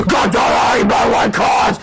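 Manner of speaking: high, slow, loud furious scream. Acted. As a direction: rage at them. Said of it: "Screamed the turbocharged down".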